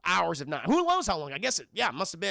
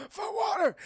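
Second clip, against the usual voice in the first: desperate cry; 13 semitones higher than usual. Retold or imitated. Blondie crawling for water.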